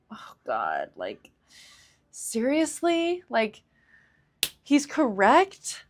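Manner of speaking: replaying the sinking feeling of bad news; dismayed disbelief. Retelling her reaction to the healer's voice note, dismayed.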